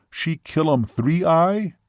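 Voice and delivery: slow, high-lifted question in broken English; imitated Seminole man. Seminole asks waitress about Juno in the picture, slowly questioning. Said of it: "even imitating his voice and gestures".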